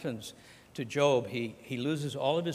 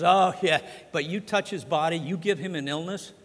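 taunting Satan; 3 semitones higher, 7 dB louder than usual. Acted.

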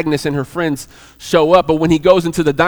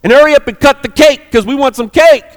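higher, louder, prodding her along; uncomfortable urging; 6 semitones higher, 5 dB louder than usual. Retold or imitated. Diner owner urges Agnes to cut the cake, uncomfortably. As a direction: hurry her up, a touch impatient. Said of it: "he starts to get uncomfortable".

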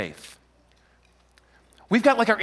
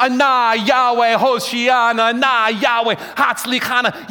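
high, raised chant; pilgrims shouting out; 4 semitones higher, 5 dB louder than usual. Acted. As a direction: cry it out like a crowd going up. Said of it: "crying and singing and shouting out".

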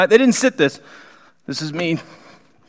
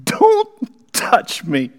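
alarmed Peter; 9 semitones higher, 4 dB louder, slower than usual. Acted. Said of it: "Simon Peter said".

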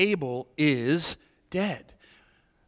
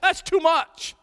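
whining complaint; 12 semitones higher, 4 dB louder, slower than usual. Retold or imitated. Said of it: "and he says".

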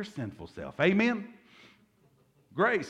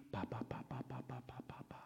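softer whispered ba ba rattled off; dismissive whispered blah-blah; 16 dB quieter, much faster than usual. Retold or imitated. Preacher mocks a grudge-holder's list of wrongs, whispered blah-blah. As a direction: brush off the endless list in a hush.

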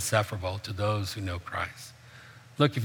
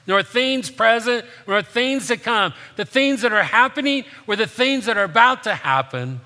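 higher and louder, driving the list home; impassioned preaching; 7 semitones higher, 5 dB louder than usual. Acted. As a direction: proclaim it like a promise no one can break. Preaching at full force on verses.

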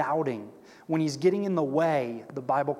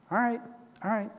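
grudging; 5 semitones higher, faster than usual. Retold or imitated. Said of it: "you don't see a whole lot of enthusiasm".

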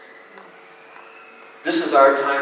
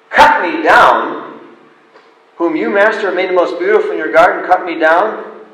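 louder, higher cry of disbelief; shocked disbelief; 3 semitones higher, 4 dB louder than usual. Acted. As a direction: protest in shock. Bamboo shocked at master.

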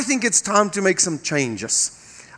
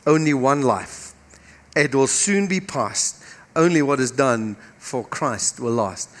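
slow, solemn poem recitation; recited poem; 5 semitones lower, slower than usual. Acted. Preacher reciting a poem to the congregation, slow and solemn. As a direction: recite it slowly and reverently.

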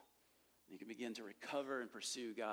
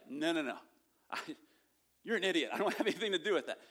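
loud, higher scoffing brush-off with a laugh; a hammed-up mocking refusal; mocking dismissal; 4 semitones higher, 7 dB louder, faster than usual. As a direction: play the scoffer waving it all off. Mocking rejection acted out.

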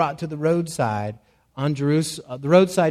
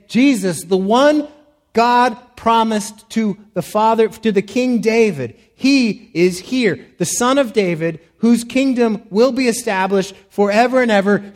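louder, higher, impassioned preaching voice; fervent preaching; 5 semitones higher, 5 dB louder than usual. Acted. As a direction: proclaim it as a fervent announcement. Voices Bartimaeus' fervent cry.

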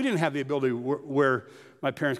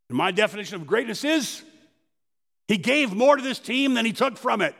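tough coach; 8 semitones higher, 4 dB louder than usual. Retold or imitated. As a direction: lightly voice a coach who chews nails. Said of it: "chewed on nails all the time".

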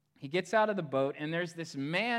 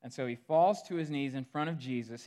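ordinary talk, nothing marked

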